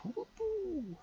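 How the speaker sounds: very high, squeaky whoop; gleeful whoop. Real, not acted. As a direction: let out a silly celebratory squeal. Celebrating game start.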